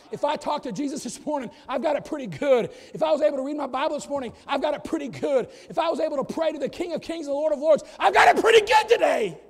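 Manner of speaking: preaching that builds to a shout; building preaching. Acted.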